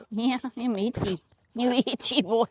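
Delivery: lightly put-on doggy-talk voice; playful pet talk. Owner teasing her itchy dog, playful pet talk. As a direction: tease the dog with a light play voice.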